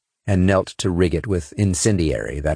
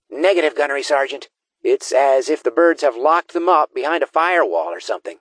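raised-pitch tech specialist voice; higher character voice; 8 semitones higher than usual. A narrator's reading. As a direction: speak as the squad's tech expert. Gee Whiz reports.

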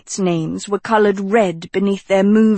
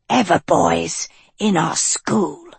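witch voice; 2 semitones lower than usual. A narrator's reading. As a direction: grumble it as a witch. Second witch voice.

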